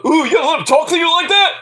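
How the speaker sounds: high, teasing voice egging him on; mocking friend. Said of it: "his friend's like".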